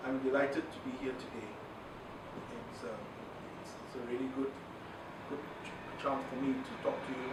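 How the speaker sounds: low, quiet, dragging speech; slow, lifeless delivery. Shown as a demonstration. Trainer showing a class poor speed, slow and subdued. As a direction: say it slowly and without energy, pauses between phrases. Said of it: "let's go to speed".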